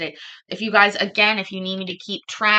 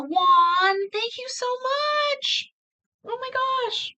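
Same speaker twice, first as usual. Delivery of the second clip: excited thanks; slower than usual. Real, not acted. Thanking donor on stream.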